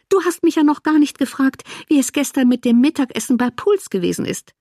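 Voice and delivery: complaining invalid sister. Acted. Woman chides her sister for not asking about dinner, piqued. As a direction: lift the voice, mildly offended.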